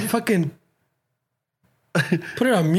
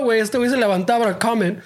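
ordinary talk, nothing marked

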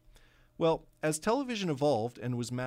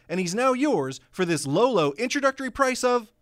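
loud, high hard-sell pitchman; home shopping host; 6 semitones higher, 8 dB louder than usual. Acted. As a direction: push it like a TV infomercial. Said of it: "this hard sell".

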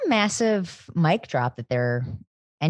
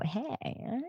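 flirty voice; 3 semitones higher, 12 dB quieter than usual. Pretend. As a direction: breathe it out like a pickup line. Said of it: "All I have to do is go".

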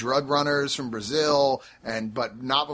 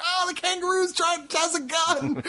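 high-pitched, hammed-up alarm; mock panic. Startled shooters reacting to an armed kangaroo, mock panic. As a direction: cry out in comic mock terror.

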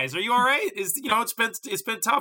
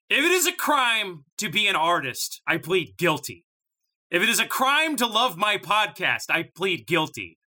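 passionate defense speech; 7 semitones higher, 3 dB louder than usual. Pretend. Mock trial defense statement.

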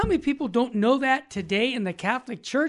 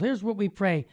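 ordinary talk, nothing marked

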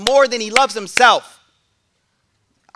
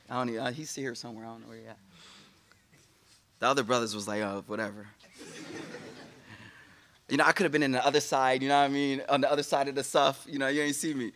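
muttered aside; 9 semitones lower, 11 dB quieter, faster than usual. Real, not acted. Joking aside about the brother.

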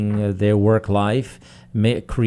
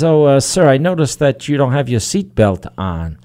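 higher, louder traffic cop; lightly voiced cop; 4 semitones higher, 7 dB louder than usual. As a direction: lightly play an officer addressing a driver.

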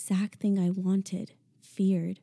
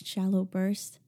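usual speaking voice

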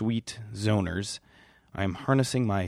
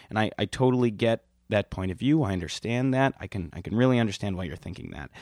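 ordinary delivery

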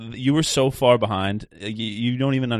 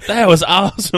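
high, loud mock Southern accent; Southern impression voice; 9 semitones higher, 8 dB louder than usual. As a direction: copy a friend's mock Southern cheer. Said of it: "whenever you do that voice".

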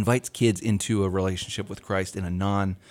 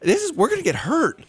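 mock kid panic; 9 semitones higher than usual. Little kid panics about torches.

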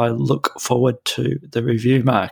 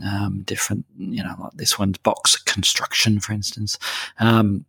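ordinary talk, nothing marked

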